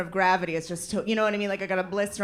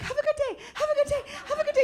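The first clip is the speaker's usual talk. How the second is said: very high, rushed "have a good day"; forced politeness; much faster than usual. Retold or imitated. Holding the door for everyone, high forced chirp. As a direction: chirp it like a fake-cheerful doormat. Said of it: "you're just standing there like".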